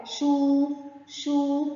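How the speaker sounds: "شو" stretched as a long shoo. Shown as a demonstration. Teacher letting pupils hear waw, "شو" drawn out long. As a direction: hold the oo in "شو" steady. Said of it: "شين ضمة مد بالواو".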